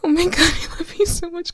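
high, loud, tearful rush of joy; overjoyed. Acted. Partner just said yes to the proposal, overjoyed. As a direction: burst out, half laughing, half crying.

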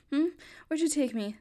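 ordinary talk

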